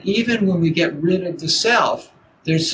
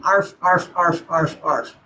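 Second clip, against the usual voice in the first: dog barking. Retold or imitated.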